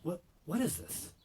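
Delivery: stare at it in disbelief; baffled disbelief. Retold or imitated. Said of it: "I'm like".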